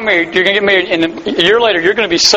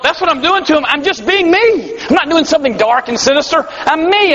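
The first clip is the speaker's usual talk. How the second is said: higher-pitched, exasperated self-defence; defensive protest; 6 semitones higher than usual. Acted. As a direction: protest your innocence, voice pitched up. Acting out a parent's defensive protest.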